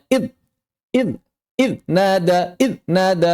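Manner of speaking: "id" chopped short without a flowing buzz; faulty abrupt "id", repeated in bursts; sound cut off. Shown as a demonstration. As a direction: stop dead on each "id" before "nada". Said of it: "Tidak mengalirkan suaranya".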